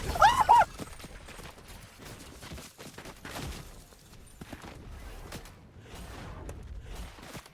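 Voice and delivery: long airless high laugh; real helpless shrieking laughter; breathless shrieking laugh. Real, not acted. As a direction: wheeze out a shrill laugh. After screaming at loss.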